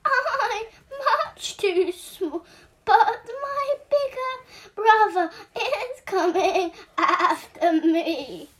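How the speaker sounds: high, loud squeaky goat; little goat. Acted. Little goat talks the troll out of it, high squeaky voice. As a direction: pipe up like a tiny goat. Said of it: "little goat pleads to troll".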